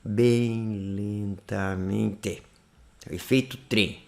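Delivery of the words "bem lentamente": extremely drawn-out syllables, soft and low; very slowly. Shown as a demonstration. Teacher demonstrating the train effect, extremely slow. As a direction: stretch every syllable like a train starting. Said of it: "fazendo lentamente".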